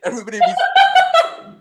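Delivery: loud, high laughter through the words; laughing hard at the family memory. Real, not acted.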